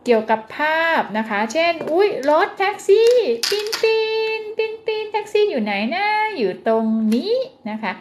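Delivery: high, lively picture-book voice; animated reading to a toddler. Shown as a demonstration. Speech therapist demoing picture-book reading for a parent, high and animated. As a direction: point and exclaim like reading to a small child. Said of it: "พูดเป็นประโยคแต่ก็มาเน้นให้นะคะ".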